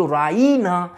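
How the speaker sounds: Raina with the middle "ee" held; stress on middle syllable. Shown as a demonstration. Showing how mother said it, Raina stressed on "ee". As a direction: lean hard on the "ee" in Raina. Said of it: "এই ইয়েতে সে জোর দিল".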